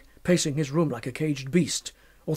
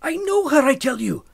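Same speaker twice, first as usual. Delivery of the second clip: much higher and loud, pleading; frantic insistence; 8 semitones higher, 5 dB louder than usual. Acted. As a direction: insist wildly, voice climbing. Fettes insists to the body-snatchers.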